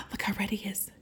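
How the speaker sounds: soft unvoiced whisper. Real, not acted. Reader whispering to the child about Froggy blushing. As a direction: lean in and whisper it.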